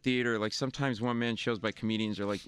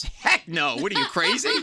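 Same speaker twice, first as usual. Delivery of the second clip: louder mock-indignant retort; incredulous scoff; 5 semitones higher, 6 dB louder than usual. Woman scoffing at the comedian's dumb question, loud and incredulous. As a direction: toss it back like he's nuts.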